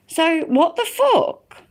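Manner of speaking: high, slow, disbelieving outrage; slow incredulous what the fuck; indignant. Real, not acted. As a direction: drag it out in disbelief. Outraged at police arrest.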